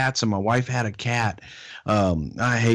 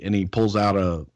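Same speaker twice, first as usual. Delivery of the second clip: ordinary talk, nothing marked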